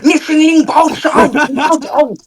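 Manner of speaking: hammed-up Chinese gabble; mock Chinese. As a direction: imitate calling an order to the kitchen in fake Chinese. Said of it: "it's like that".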